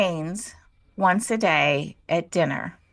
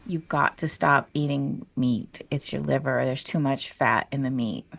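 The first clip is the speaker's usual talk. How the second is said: stern advisor; 8 semitones lower, 4 dB quieter than usual. Retold or imitated. Mentor on the phone telling her to stop meat, stern advisor. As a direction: deliver it low and measured, as firm advice.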